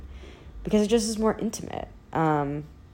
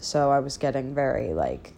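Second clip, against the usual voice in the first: normal talking voice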